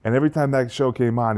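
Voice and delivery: ordinary delivery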